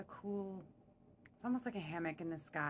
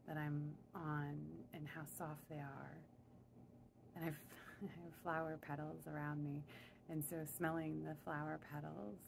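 guided meditation; 5 dB quieter than usual. Real, not acted. Guide leading listeners upward in their imagined elevator, calm meditation voice. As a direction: lead them gently inward, phrase by phrase.

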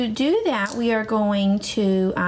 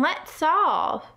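lifted, upbeat teacher voice; high excited praise; 6 semitones higher than usual. Acted. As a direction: praise them like a proud cheerleader.

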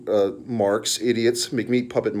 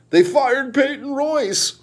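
high, slow mock-distraught wail; mocking whiny fan; 10 semitones higher, slower than usual. Podcaster mocking fans over releases, in a high drawn-out whine. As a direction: drag it out like a heartbroken fan.

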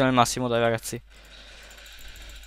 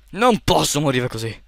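usual speaking voice

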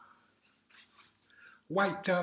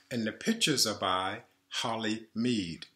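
ordinary delivery

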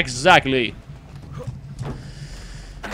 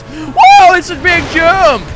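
excited shout; 11 semitones higher, 9 dB louder, much slower than usual. Real, not acted. Car jump in game.

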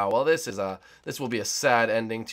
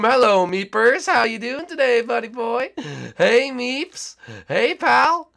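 high, loud sing-song hello to a victim; playful high taunt; mock friendly greeting; 11 semitones higher, 8 dB louder, slower than usual. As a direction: greet him like a sly pal before the trap. Taunting a player in game.

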